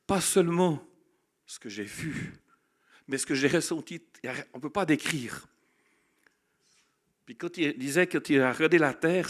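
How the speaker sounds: loud, building sermon storytelling; impassioned preaching. Acted. Pastor recounting the astronaut's return to the congregation, loud impassioned preaching. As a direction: tell the astronaut's story with full conviction.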